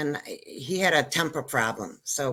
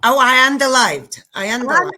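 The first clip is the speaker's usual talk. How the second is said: loud correction; 7 semitones higher, 9 dB louder than usual. Shown as a demonstration. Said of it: "be careful, be careful".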